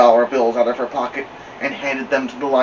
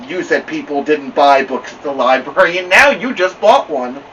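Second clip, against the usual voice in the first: triumphant; 3 semitones higher, 6 dB louder than usual. Acted. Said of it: "said triumphantly".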